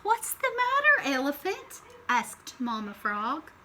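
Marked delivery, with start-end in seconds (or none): 0.0-1.8 curious question. Acted. Mama Frog asks.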